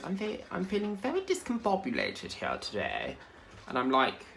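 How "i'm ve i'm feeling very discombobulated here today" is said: lightly voiced higher lady; a hint of a posh TikTok lady; imitating TikTok lady. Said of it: "And she goes".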